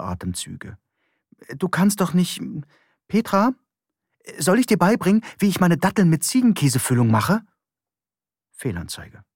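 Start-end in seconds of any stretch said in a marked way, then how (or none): 1.6-7.4 raised, eager man trying to keep her awake; higher pitched and coaxing, quickly at the end; Johann's voice. A narrator's reading. Johann coaxing sleepy Petra, higher eager voice.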